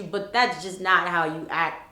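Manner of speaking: ordinary delivery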